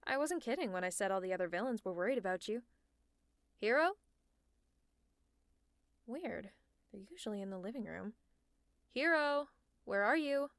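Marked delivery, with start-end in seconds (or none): 3.6-3.9 loud call across the house. Acted. Calling out through the house. 8.9-10.5 loud searching call. Acted. Calling out searching.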